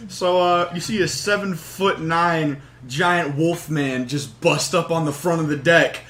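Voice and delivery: usual speaking voice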